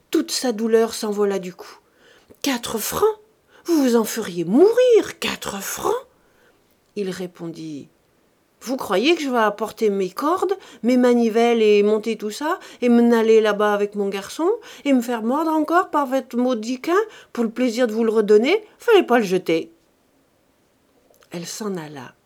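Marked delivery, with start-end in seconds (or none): 2.4-6.1 louder, higher, shocked indignation; outraged protest. Acted. Widow outraged at price. 8.6-19.7 loud, grumbling reproach; well-digger lectures the stingy widow, loud gruff scolding. Acted.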